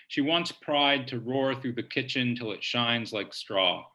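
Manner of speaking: usual speaking voice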